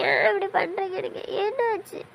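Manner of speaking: crying question that rises high on the last word. Acted. Wife still crying at the injured husband who hid it, sobbing. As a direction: sob out the question. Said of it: "நீ அழுறத நிப்பாட்டு".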